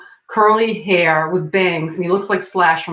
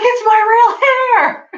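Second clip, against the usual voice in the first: much higher and louder, outraged squeal; indignant outburst; 6 dB louder than usual. Retold or imitated. Friend telling security his hair isn't a wig, indignant yell. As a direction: blurt it out in disbelief at the guard.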